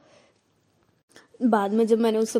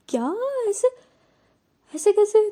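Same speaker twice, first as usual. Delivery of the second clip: shocked disbelief; 9 semitones higher than usual. Retold or imitated. Nandini hearing the girl's confession, shocked high outburst. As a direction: blurt it out in stunned disbelief. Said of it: "वो shock में".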